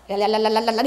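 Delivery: playful babbling imitating tongues; mock tongues babble. Pastor imitating children at play, light mock babble. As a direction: babble like a child copying adults. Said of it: "그게 놀이예요".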